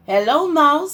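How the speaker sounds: high bright chirpy hello; cheery duck greeting. Acted. Duck calls out to Cow as he rides past, high and cheery. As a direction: greet like a happy little duck on a bike.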